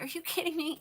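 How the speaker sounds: high, incredulous retold reaction; retold stunned reaction to a sellout, pitched high; mock disbelief. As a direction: replay your own stunned reaction. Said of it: "I was like".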